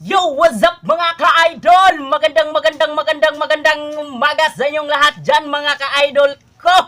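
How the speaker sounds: high, slow, drawn-out hype greeting to viewers; vlogger greeting. Acted. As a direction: stretch out the hello to the fans, upbeat. (masiglang pagbati ng vlogger, mataas at mabagal)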